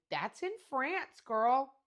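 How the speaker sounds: loud, high teasing disbelief; mock incredulous. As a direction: tease her, loud and amazed. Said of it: "I'm like".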